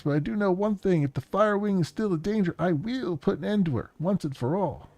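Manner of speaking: high-pitched, worried brother with halting starts; urgent, jittery man. Acted. Man leaving on a mission warns Hermie, high and urgent. Plays excited man in recording.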